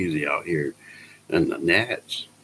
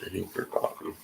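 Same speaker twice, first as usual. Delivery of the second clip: soft murmur; 10 dB quieter than usual. Real, not acted. Waiting for coffee.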